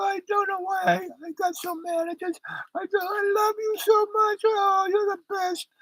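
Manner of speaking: put-on very high, whispery falsetto of a drunk wife. Drunk wife apologising from the lifeboat, in a very high falsetto. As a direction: coo it high and breathless, full of apology. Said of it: "And she goes".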